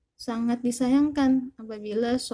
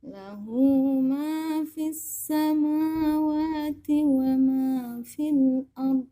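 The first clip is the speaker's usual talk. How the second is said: Quran recitation; 2 semitones higher than usual. Acted. Recites Ayat al-Kursi.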